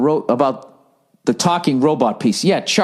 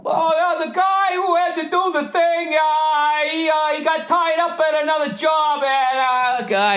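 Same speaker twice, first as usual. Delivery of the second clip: much higher, whiny excuse-making voice; mocking contractor; 12 semitones higher than usual. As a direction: parody the flaky contractor with a high, fake-breezy tone.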